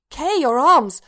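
just-woken shock at the droid's arms; startled exclamation. A narrator's reading. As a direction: blurt it in alarm. Waking, startled at missing arms.

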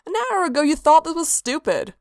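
a touch indignant, teasing; vaguely affronted. A narrator's reading. Sanguine teases Hermaeus, mildly affronted. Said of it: "vaguely affronted".

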